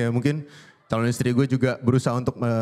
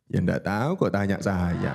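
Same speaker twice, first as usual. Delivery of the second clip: slow, lower mock impersonation; lightly voiced impression; 6 semitones lower, slower than usual. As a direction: drop low and drag it like a famous figure. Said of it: "gue bisa niruin". (tiruan suara tokoh, pelan dan rendah)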